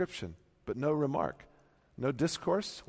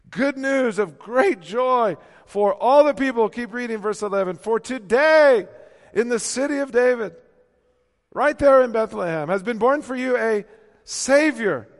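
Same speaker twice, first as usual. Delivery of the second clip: loud, high, ringing preacher's climb; full-voiced pulpit proclamation; preaching at full force; 11 semitones higher, 12 dB louder than usual. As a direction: lift it up and proclaim.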